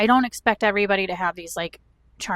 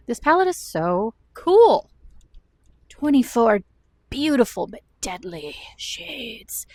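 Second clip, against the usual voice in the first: mock ad read; 3 semitones higher, 4 dB louder, slower than usual. Showing off makeup palette.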